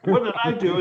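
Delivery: a replayed, baffled what-did-I-do; indignant disbelief. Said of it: "and I said".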